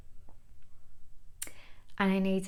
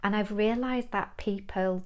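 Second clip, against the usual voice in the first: ordinary delivery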